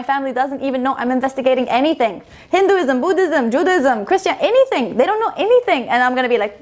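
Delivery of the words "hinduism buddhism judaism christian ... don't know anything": freaking out. Retold or imitated. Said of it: "I was freaking out".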